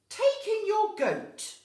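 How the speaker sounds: loud, high, slow advice; wise old man. Acted. Wise man advising the old lady, loud slow counsel. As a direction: declare the idea grandly. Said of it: "said the wise old man".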